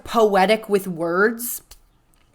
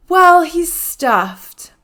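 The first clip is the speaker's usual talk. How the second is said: slow deadpan reveal; 8 semitones higher, 6 dB louder, much slower than usual. Retold or imitated. Quoting his slow reveal.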